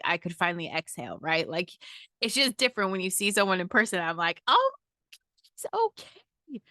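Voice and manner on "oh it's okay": relieved. Retold or imitated. Relief on seeing Ed whole.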